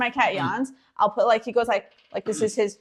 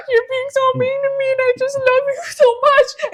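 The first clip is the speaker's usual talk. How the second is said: loud, high, hammed-up sobbing; exaggerated tearful guilt trip; mock crying mom; 9 dB louder than usual. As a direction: wail like a wounded mother, badly overdone. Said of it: "And then she cries".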